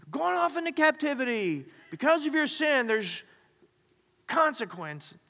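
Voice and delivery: high, loud proclaiming of doom; doom-prophet voice. Acted. Jeremiah's doom message voiced.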